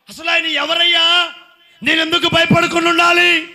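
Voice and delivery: loud, high preaching to the congregation; full-force preaching. Acted. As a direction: preach it out, rousing the crowd.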